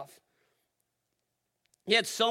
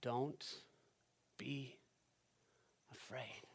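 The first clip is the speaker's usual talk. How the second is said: much softer, with long pauses between "Don't", "be", "afraid"; gentle comfort; 6 semitones lower, 14 dB quieter, slower than usual. Shown as a demonstration. Pastor comforting fearful listeners, soft and slow. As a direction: lay each word down slowly and tenderly. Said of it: "words of comfort".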